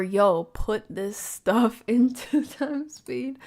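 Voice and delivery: loud, slow, laughing "yo"; amused exclamation. Shown as a demonstration. Second take, excited reading.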